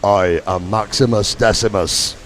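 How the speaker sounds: very deep booming movie-hero voice; low, solemn gladiator proclamation; Gladiator impression. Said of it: "your best Gladiator impression".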